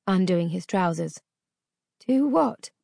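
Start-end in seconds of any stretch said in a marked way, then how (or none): 2.0-2.7 high, bewildered question; confused fear. A narrator's reading. Girl asking her captor what he means. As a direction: ask in frightened confusion. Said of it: "I asked".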